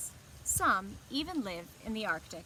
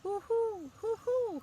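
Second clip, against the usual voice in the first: much higher, hooting owl call; a round, falling hoo-hoo for the children; 10 semitones higher, slower than usual. Acted. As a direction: hoot it like a night owl. Owl voiced in picture book.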